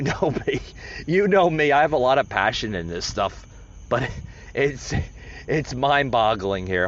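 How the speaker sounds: normal talking voice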